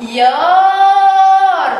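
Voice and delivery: خيار with a loud, high, long-held aa; almost chant خيار, sustaining the aa; long vowel. Shown as a demonstration. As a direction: stretch the aa in خيار loudly. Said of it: "ولكن تعتبر مد بالإيه؟".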